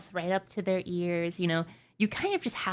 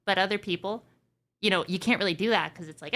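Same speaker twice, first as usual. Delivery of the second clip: normal talking voice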